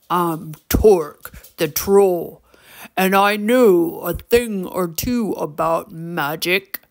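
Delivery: deep, gravelly troll, slow and boastful; slow gravelly troll drawl; Torque. A narrator's reading. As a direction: introduce yourself in a low, rough creature voice. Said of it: "deep and gravelly voice".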